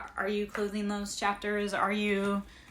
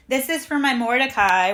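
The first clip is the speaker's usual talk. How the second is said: calling out; 4 semitones higher, 14 dB louder than usual. Real, not acted. Calls out to someone nearby.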